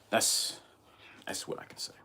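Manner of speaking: a hushed whispered aside. Real, not acted. Ending his wish against a commenter, whispered. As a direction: drop to a breathy whisper. Trailing off after a curse.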